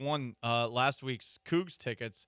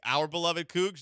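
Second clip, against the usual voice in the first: normal talking voice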